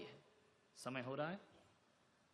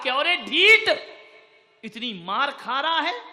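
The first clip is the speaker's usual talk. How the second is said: higher and much louder scolding; angry king; 8 semitones higher, 13 dB louder than usual. Acted. King to the laughing gardener, angry scolding. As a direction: bark at the stubborn man.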